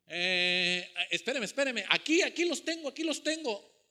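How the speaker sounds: mock panic, pitch climbing on the repeats; flustered stalling. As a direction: play someone fumbling for the answer. Flustered person searching for numbers.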